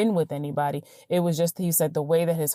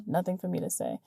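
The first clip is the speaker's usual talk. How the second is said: normal talking voice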